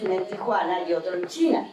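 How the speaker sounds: ordinary delivery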